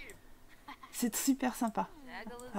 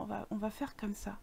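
unvoiced murmur to herself; whispered to self; 4 semitones lower, 13 dB quieter than usual. Real, not acted. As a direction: whisper while concentrating. Concentrating murmur. (chuchoté pour soi)

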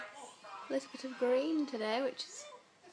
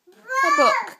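high loud slow sing-song; 12 semitones higher, 13 dB louder than usual. Real, not acted. Rises to baby voice.

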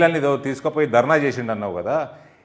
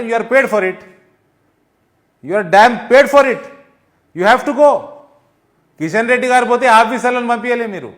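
a politician exploding at a rival minister; furious shout; 8 semitones higher, 5 dB louder than usual. Real, not acted. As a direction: yell the blame at him, word by word.

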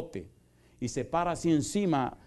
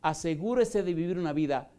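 fiery pulpit preaching, voice raised and pushing; full force preaching; 5 dB louder than usual. Acted. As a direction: thunder it at the congregation like a revival preacher.

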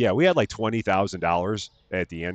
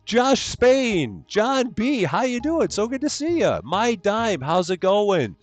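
high, sing-song hellos to each viewer; cheery stream greeting; 13 semitones higher, 4 dB louder than usual. Real, not acted. Streamer welcoming chat members by name, high and sing-song.

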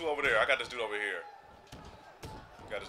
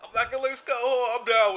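excited; 9 semitones higher, 7 dB louder than usual. Real, not acted. Excited about playing Scott Hall.

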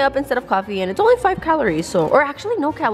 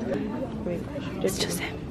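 quiet; 5 semitones lower, 12 dB quieter, much slower than usual. Real, not acted. Sneaking, scared of who's there.

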